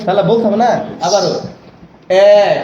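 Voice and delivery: ordinary talk, nothing marked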